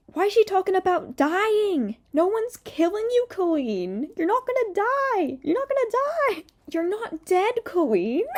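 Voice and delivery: high-pitched, incredulous outburst; exasperated protest. Real, not acted. Telling Colleen nobody is killing her, high exasperated protest.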